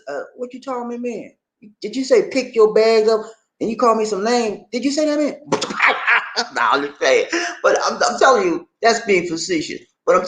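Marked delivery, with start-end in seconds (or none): 0.1-5.4 lightly voiced street tough, high and mock threatening; imitating a tough guy. Tough guy confronting a rude foreigner, mock menace.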